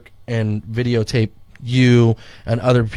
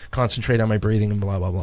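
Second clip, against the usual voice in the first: ordinary delivery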